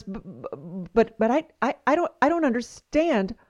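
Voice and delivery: halting, confused stammer; acted stammer rising into a baffled question. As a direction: stutter on the first sounds, then ask, bewildered. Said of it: "Chuck stammers".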